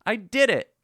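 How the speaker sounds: imitating a self-satisfied player's whoop; mock triumphant cheer. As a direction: cheer as if you just nailed it. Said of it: "The moment you think".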